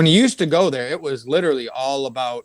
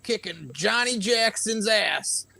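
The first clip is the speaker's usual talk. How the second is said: lightly voiced cowboy, pitched up and drawn out; a hint of higher, slow cowboy; foul-mouthed cowboy; 6 semitones higher, slower than usual. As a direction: imitate a crude old storytelling cowboy, slowly. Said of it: "foul-mouthed cowboy".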